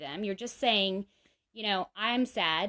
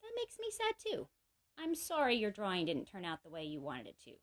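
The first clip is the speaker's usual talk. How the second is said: tender empathy; 8 dB quieter than usual. Shown as a demonstration. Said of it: "when you're sad".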